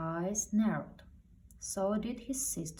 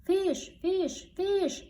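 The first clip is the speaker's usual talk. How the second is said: high, loud squawking gull cries; squawking seagulls; 13 semitones higher, 7 dB louder, much slower than usual. A narrator's reading. Seagulls diving at the fish tank, loud high squawks. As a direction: screech it like greedy seagulls diving. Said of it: "squawked seagulls".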